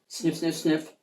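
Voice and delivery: ordinary delivery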